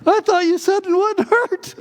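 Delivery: very high, loud pained wail; imitated yelling. Brother just shot himself in the foot, high imitated yelling. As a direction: wail in mock agony and outrage. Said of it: "he started yelling".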